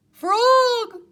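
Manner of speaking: very high, loud call. Acted. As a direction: call Frog out proudly. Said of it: "cried Toad".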